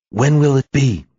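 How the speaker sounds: bursting to know the date; excited question. A narrator's reading. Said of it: "Finn asked excitedly".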